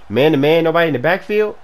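normal talking voice

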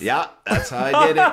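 high and loud, laughing through it. Real, not acted. Comedy duo cracking up about the mayor, laughing loudly. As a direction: crack up while owning the joke. Joke, laughing mid-line.

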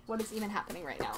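normal talking voice

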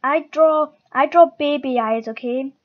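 loud defensive outburst; defensive shout. Real, not acted. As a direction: snap back in defence. Defending drawing to Emily.

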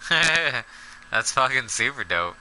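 slow laughing delight. Real, not acted. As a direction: laugh through the words slowly.

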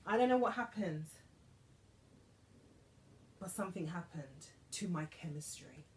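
normal talking voice

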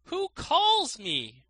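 high, loud and slow; cried out. A narrator's reading. Student calls to the hidden voice, high and loud. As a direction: call out, puzzled, to an unseen voice. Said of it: "cried out".

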